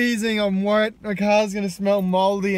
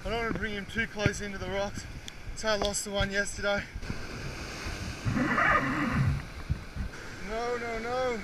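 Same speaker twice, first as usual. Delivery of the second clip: tense and quiet; 7 dB quieter than usual. Real, not acted. Tense fight near the rocks.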